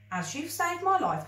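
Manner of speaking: high, bright little creature voice; a tiny being making a grand offer; lightly voiced magical creature; tiny grateful creature. A narrator's reading. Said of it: "continued the chimichanga".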